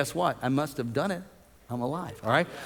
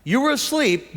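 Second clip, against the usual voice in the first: ordinary delivery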